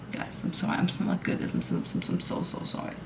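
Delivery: barely audible, stammering whisper; whispered apology. Real, not acted. Vlogger apologizing to viewers for not being good, whispered.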